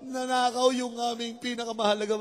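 high mock-crying wail; acted weepy lament; tearful complaint. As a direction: whine it high as if bawling. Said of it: "Ikaw, ba't ka umiiyak?".

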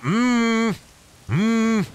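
high hummed phone ringtone, in short beeps; flip phone ringtone. Pretend. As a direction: hum like an old flip phone ringing. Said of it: "turn into a fucking Razr flip phone".

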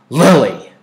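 loud, sharp scolding of the name; sharp rebuke. A narrator's reading. Mother sharply cuts off her daughter, scolding. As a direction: snap the name to stop her. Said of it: "Mother spoke very sharply".

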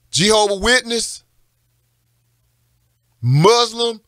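fiery sermon delivery, pitched high and insistent; full-force preaching. Real, not acted. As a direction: preach it hard, stretching the key words.

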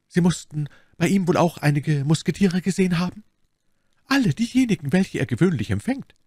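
a hint of character above the narration; lightly voiced Milady in conversation. Milady draws out the novice about the musketeers, lightly voiced.

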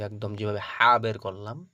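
normal talking voice

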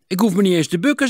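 hoog, luid en snel; opgetogen pochen. A narrator's reading. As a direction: flap het er trots en vlug uit. Personage pocht in verhaal. (opschepperig, hoog en snel)

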